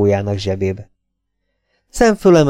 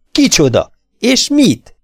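shouting; 9 semitones higher, 3 dB louder, slower than usual. A narrator's reading. Sam keeps shouting at her.

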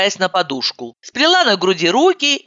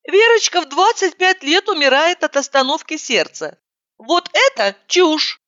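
put-on high girlish voice; 11 semitones higher than usual. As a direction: protest in her high voice. Heroine argues, high voice.

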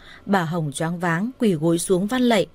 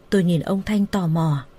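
plain reading voice

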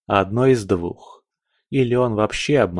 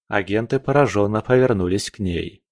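ordinary delivery, nothing marked

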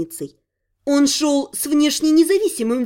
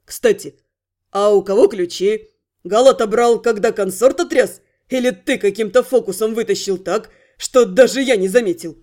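lower, lively voice of a cheeky thief; approving thief voice; 5 semitones lower than usual. Acted. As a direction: praise him like a pleased rogue. Thief Rent asking, same voice.

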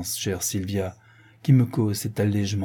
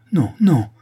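louder, urgent no no; interrupting cry; 4 semitones higher, 6 dB louder than usual. A narrator's reading. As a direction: cut her friend off sharply. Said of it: "s'écrie Antonine, interrompant son amie".

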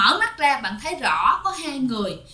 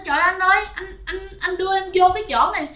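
high-pitched, hurried alarm; retold panic, pitch jumping up; panicked cry; 8 semitones higher, faster than usual. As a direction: imitate a frightened wife crying out at her husband. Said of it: "tự nhiên bạn la lên".

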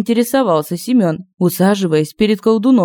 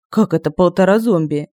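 ordinary delivery

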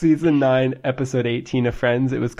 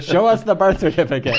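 mock heckler; 7 semitones higher, 5 dB louder than usual.

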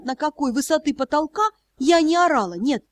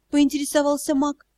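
plain reading voice

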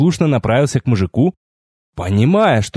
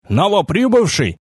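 higher-pitched, lively hail; perked up; 7 semitones higher than usual. A narrator's reading. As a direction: greet a newcomer with sudden eagerness. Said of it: "оживился он". (оживлённый возглас)

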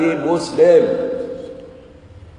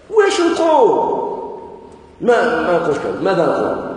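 mock questioner; 2 semitones higher than usual. Preacher voices a puzzled questioner.

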